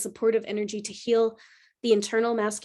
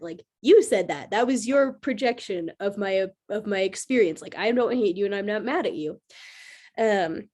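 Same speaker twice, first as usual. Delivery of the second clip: ordinary talk, nothing marked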